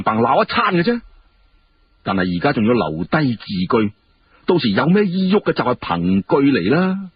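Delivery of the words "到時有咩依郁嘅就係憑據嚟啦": ordinary delivery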